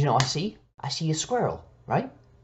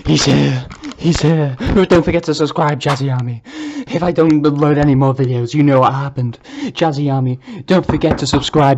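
loud, urgent panic; panicked; 7 dB louder than usual. Real, not acted. Warning the Jazzy Army he is here, loud panic. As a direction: rush the goodbye in fear.

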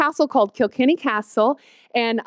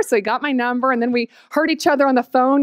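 usual speaking voice

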